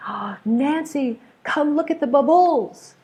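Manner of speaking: bubbles misstressed on the second syllable, bub-BULLS; excited child learner. Acted. Child calling teacher at a birthday party, excited and high. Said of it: "really excited. Maybe it's a child".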